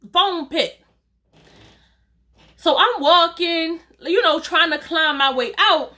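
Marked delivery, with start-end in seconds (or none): none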